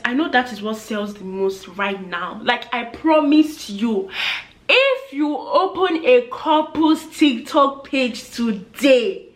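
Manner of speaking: normal talking voice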